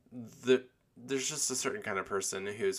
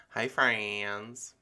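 normal talking voice

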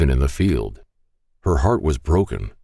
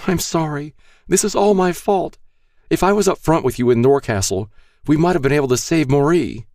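high, guilty and tearful; tearful apology; 12 semitones higher than usual. A narrator's reading. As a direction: apologize through tears. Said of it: "She looked up, her eyes red".

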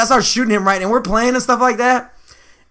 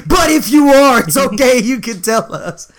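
high, insistent fake understanding; mock pushy reassurance; 4 semitones higher, 3 dB louder, slower than usual. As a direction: press him loudly, as a parodied pushy ally. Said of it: "she get really pushing".

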